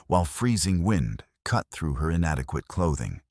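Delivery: plain reading voice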